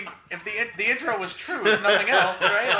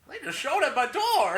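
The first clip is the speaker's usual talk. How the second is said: higher, nervous comic twang; Don Knotts voice; 8 semitones higher than usual. Pretend. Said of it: "that's more Don Knotts is what I'm doing".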